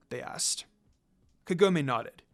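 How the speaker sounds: ordinary delivery, nothing marked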